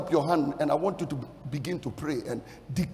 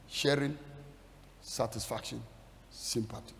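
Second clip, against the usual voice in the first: fading to a whisper; 8 semitones lower, 11 dB quieter than usual. Shown as a demonstration. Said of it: "Three things I see there".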